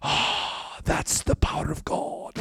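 hushed breathy whisper of awe; awed preacher whispering into the mic; soft breathy power of God; whispered pronouncement. Retold or imitated. Preacher whispers after the stammer.

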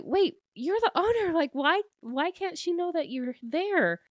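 high, incredulous questioning; imitated high-voiced disbelief; mock bewilderment. Retold baffled questioning of him.

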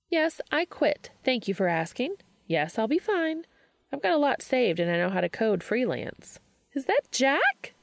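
put-on sing-song breezy young woman brushing off worry; upbeat Charlie. Narrator voices Charlie.

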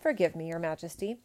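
plain reading voice

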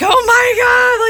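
high, loud, slow mortified "oh my God"; embarrassed shriek at a husband's rudeness; mortified gasp. Retold or imitated. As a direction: cry it out, horrified and slow. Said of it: "And I'm like".

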